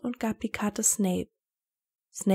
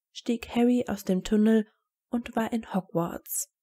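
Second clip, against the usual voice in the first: plain reading voice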